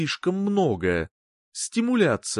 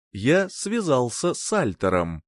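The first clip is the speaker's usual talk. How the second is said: plain reading voice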